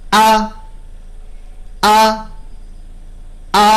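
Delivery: আ with a sharp glottal stop; firm hamza. Shown as a demonstration. Tajweed teacher drilling the hamza: আ from closed vocal cords. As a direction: close the vocal cords, then release আ firmly. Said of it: "vocal কর্ডসের তার দুইটাকে প্রথমে লাগানো রাখতে হবে".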